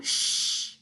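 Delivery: quick hushing shh; shushing. Acted. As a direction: hush quickly. Hushing after the sneeze.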